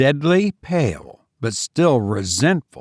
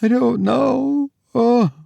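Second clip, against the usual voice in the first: very high, drawn-out helpless wail; faint, frightened girl giving out; feeble gasping; 13 semitones higher than usual. A narrator's reading. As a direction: falter slowly, pitched right up. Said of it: "gasping feebly".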